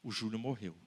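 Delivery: low, soft, grave aside; solemn refrain. Acted. Preacher reminding the crowd the boy is dead, low and soft. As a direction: drop the voice low and quiet, heavy.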